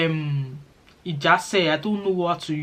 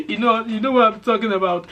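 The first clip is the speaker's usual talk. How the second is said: laughing; 5 semitones higher than usual. Real, not acted. Teasing viewers that they will pick up the accent, laughing. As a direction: chuckle knowingly at the viewer.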